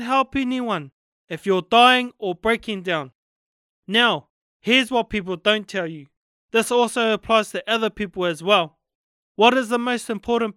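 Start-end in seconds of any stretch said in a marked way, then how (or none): none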